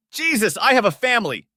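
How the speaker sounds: high, loud outburst; angry yell. A narrator's reading. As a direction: yell it in shocked outrage. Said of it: "he yelled".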